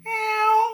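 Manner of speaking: high, loud mock meow; imitated cat meow. Shown as a demonstration. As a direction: mew like a cat. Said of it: "Kalau kucing". (menirukan kucing mengeong)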